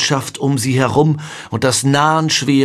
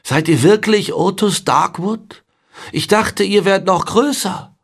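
put-on smiling boy; 9 semitones higher than usual. A narrator's reading. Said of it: "Ein Lächeln erschien auf dem Gesicht".

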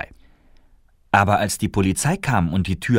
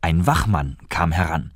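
plain reading voice

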